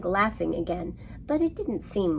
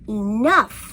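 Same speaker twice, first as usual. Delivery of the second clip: lion cutting in; 4 semitones higher, 8 dB louder than usual. A narrator's reading. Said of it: "said the lion".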